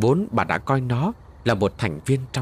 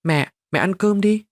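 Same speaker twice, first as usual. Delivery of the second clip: urging mom; 8 semitones higher than usual. A narrator's reading. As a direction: push mom to eat with a child's eagerness. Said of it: "Phát mới giục mẹ".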